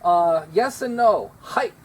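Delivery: ordinary delivery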